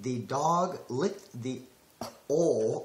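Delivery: slow, careful neutral diction; halting word by word, straining to lose the southern accent; deliberate, separated words; attempted accentless. Shown as a demonstration. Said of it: "I got this".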